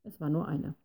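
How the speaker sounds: ordinary delivery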